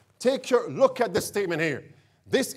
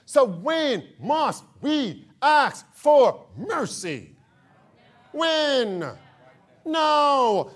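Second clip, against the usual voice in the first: preaching shout; 3 semitones higher, slower than usual. Real, not acted. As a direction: shout it out slowly.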